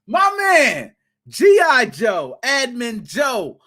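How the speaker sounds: high, slow, stretched hype shout. Real, not acted. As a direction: call him out like a ring announcer. Hyping up Admin Joe.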